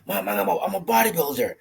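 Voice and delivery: put-on a child thrilled with his new medal; excited kid. Young kid telling his mom he's a bodybuilder, excited child voice. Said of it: "He goes".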